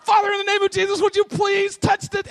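very high, loud, hammed-up plea; panicked prayer. Preacher re-enacting his faithless prayer over the woman, shrieked panic. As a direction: blurt a desperate prayer at the top of the pitch range.

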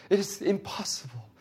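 IMPOSSIBLE pitched high with weight; heartfelt emphasis on IMPOSSIBLE; emphatic. Real, not acted. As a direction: lean earnestly on the word impossible. Preacher stresses how hard forgiving is.

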